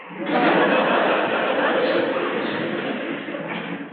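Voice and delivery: a long, breathy laugh of pure delight. Real, not acted. Preacher to congregation, laughing at his own boldness, delighted. Delight at applying without visa.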